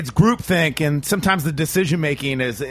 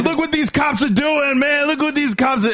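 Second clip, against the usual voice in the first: much higher, calling to the street; calling out to crowd; 12 semitones higher than usual. Retold or imitated. Yelling to passers-by.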